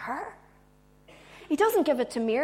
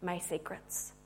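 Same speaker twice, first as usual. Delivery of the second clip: hushed private revelation; whispering; 5 semitones lower, 12 dB quieter than usual. Acted. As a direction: confide it very softly and low. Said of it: "he whispers to her".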